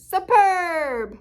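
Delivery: high, loud, odd froggy cry of delight; a frog's loud, high compliment. Acted. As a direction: announce it like a thrilled little frog. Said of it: "And the frog says".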